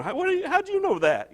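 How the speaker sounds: high, fast amazed question; startled youth voices; amazed kids. Retold or imitated. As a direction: play the baffled teens blurting it out.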